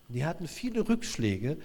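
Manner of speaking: usual speaking voice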